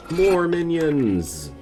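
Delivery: loud, high, drawn-out cheer; gleeful cheer. Real, not acted. Player cheering on summoned minions, gleeful cry. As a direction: call it out high and loud, stretching the words.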